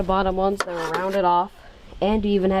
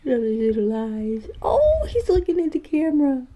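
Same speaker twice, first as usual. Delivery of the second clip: cooing; 6 semitones higher, slower than usual. Real, not acted. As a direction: coo at a tiny animal. Cooing at a cute spider.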